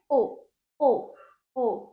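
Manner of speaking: ओ said as a clear, held long o vowel; long o sound. Shown as a demonstration. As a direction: model ओ as a long o for the class. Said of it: "इसका उच्चारण क्या है?".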